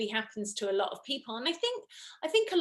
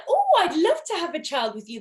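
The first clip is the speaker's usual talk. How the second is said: eager; 6 semitones higher, 6 dB louder, faster than usual. Retold or imitated. Sweet offer to a guy.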